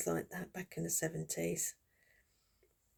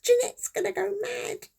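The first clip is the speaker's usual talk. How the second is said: high, loud, panicked warning; hammed-up panicking father, much higher; worried dad; 7 dB louder than usual. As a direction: fret like a dad dreading his wife's reaction. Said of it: "He said".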